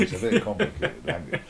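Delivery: laughing punchline. Acted. Actor telling the interviewer the punchline, laughing. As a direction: deliver the joke while laughing. Joking punchline about alien language.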